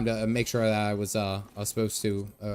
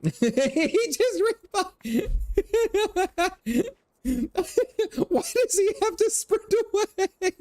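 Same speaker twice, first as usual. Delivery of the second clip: high, squealing laughter through the words; helpless high-pitched cracking up; laughing hard; slower than usual. Real, not acted. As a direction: barely get the words out for laughing. Streamer cracking up while talking.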